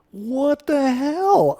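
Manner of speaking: astonished drawn-out exclamation; stunned what the hell. Real, not acted. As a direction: stretch out hell in real shock. Stunned by Microsoft's design.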